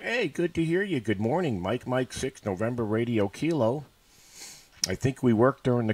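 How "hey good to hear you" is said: ordinary talk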